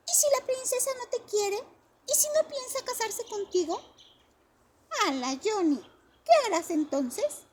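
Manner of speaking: put-on high, loud child voice challenging; doubting child. A narrator's reading. The kids question Johnny's plan, high doubting child voice. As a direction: poke holes in his plan.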